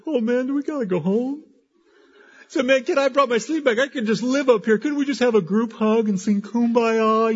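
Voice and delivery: high, loud mock pleading of men who won't go home; pleading retreat-goers. Men at a retreat begging not to go home, mock pleading. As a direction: beg in an exaggerated, high-pitched voice to stay.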